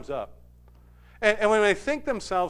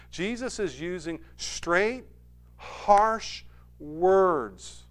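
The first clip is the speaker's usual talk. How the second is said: preaching intensely; 4 semitones higher, much slower than usual. Real, not acted. Preacher stressing Jesus' harsh words.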